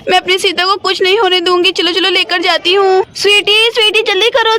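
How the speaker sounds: usual speaking voice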